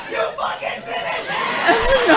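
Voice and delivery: high, slow, laughing shout; cheering on the big woman, wrestling-fan style; mock wrestling-fan yell. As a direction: egg her on like a ringside fan. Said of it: "So I was like".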